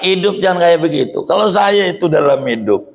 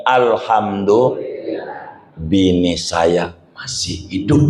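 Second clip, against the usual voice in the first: grateful sigh; 9 semitones lower, slower than usual. Shown as a demonstration. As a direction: breathe it out slowly, thankful. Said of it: "ucapkan dari mulut kita nih".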